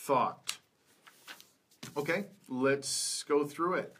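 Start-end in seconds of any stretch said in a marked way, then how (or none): 0.1-0.6 "thought" said carefully with the th at the start; th sound demo. Shown as a demonstration. Teacher models first word for learners, "thought" with initial th. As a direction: model "thought" alone, crisp initial th, falling tone. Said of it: "with the th sound".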